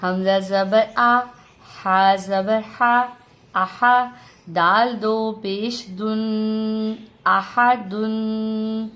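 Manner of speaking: slow, level chanted spelling; spelling drill. Shown as a demonstration. Teacher spells a new word for learners, slow chanted drill. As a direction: sound out each piece, then hold the last syllable. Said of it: "ہجے کی مشق بھی کریں گے".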